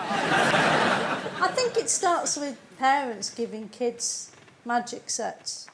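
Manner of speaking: ordinary talk, nothing marked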